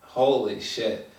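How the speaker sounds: normal talking voice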